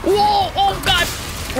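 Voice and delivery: high startled cry. Real, not acted. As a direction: jolt in surprise. Sudden danger in game.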